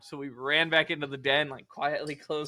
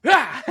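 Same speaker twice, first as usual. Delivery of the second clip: loud high jump-scare shout; sudden scare yell; 10 semitones higher, 13 dB louder than usual. Retold or imitated. Friend jumping out to scare, sudden yell. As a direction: yell it to make him jump. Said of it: "decided to scare the fuck out of me".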